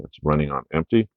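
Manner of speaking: normal talking voice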